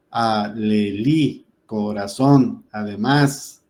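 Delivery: alelí, corazón, además, each slow with a stressed final syllable; stress on last syllable. Shown as a demonstration. Teacher giving examples of palabras agudas, last syllable stressed. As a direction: stretch alelí, corazón, además, landing on LÍ, ZÓN, MÁS. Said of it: "en la última sílaba".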